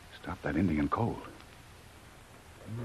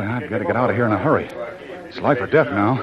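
louder and faster, rising panic; urgent alarm; 2 semitones higher, 7 dB louder than usual. Acted. Jungle Jim, hunted near the swamp, urgent and louder. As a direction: push it out fast, the hunters are closing in. Hunted man realises he's trapped.

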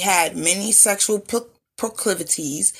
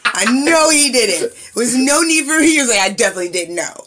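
laughing; 5 semitones higher, 7 dB louder, faster than usual. Real, not acted. Laughing at son's ignorance.

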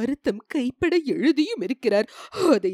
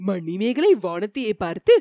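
usual speaking voice

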